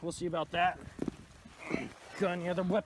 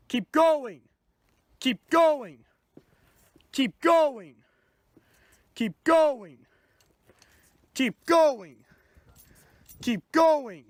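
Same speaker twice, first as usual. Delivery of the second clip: loud, firm police orders; shouting commands; 4 semitones higher, 5 dB louder, much slower than usual. Acted. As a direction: bark each step at the suspect. Officer shouting commands at suspect.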